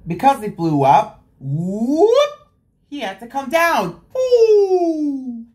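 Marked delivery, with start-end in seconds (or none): 1.4-2.3 rising whoop. Acted. Policeman flies up. 4.2-5.4 a high falling ooh, a bit louder. Acted. Policeman comes down.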